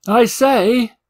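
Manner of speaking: Two Flower calling into empty corridors; shouting. Acted. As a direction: call out into the dark tunnels. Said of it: "he shouted".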